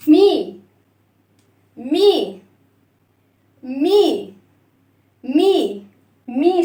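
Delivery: loud, separate "مي" with long ee; kasra vowel. Shown as a demonstration. Teacher breaking the sound for children, kasra vowel. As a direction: sound out each "مي" with a clear ee. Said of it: "نكسر الصوت، اسمعوا كده".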